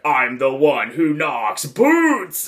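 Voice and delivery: slow, drawn-out quote with a rising BOOTS; lightly mimicked TV villain catchphrase. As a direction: stretch it out theatrically.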